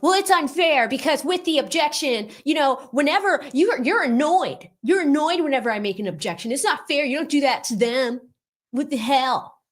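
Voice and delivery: high, whiny complaint; hammed-up tearful protest, pitched high; mock crying Lewin. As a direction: whine it like a wronged cartoon kid. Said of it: "do you see how he's crying".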